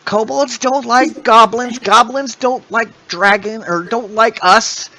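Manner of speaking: high pleading kobold queen. Acted. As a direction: beg them to save her baby.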